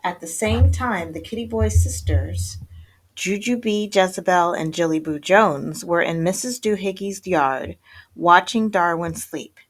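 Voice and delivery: usual speaking voice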